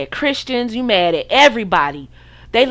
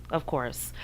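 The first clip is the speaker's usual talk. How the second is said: "Of course" repeated much softer, as an afterthought; soft echo; 9 semitones lower, 15 dB quieter than usual. Shown as a demonstration. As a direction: let "Of course" fade almost to nothing.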